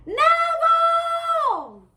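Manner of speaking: very long, high, loud call, almost sung. A narrator's reading. Startled boy calls again, very long loud shout. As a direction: throw the name as far as possible, holding it. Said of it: "he shouted again, even louder".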